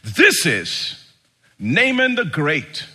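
lightly voiced grand announcement; pompous herald. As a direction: announce him grandly, building. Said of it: "the guy starts like".

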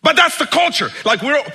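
high, loud preacher's exclamation; emphatic outburst. Real, not acted. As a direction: burst out with the point.

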